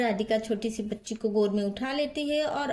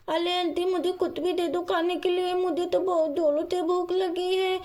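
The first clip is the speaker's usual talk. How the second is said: put-on higher-pitched childish pleading; hungry little girl; 7 semitones higher than usual. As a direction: beg aunty like a small child. Hungry child asks for food. (भूखी छोटी बच्ची की आवाज़)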